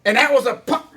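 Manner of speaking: ordinary talk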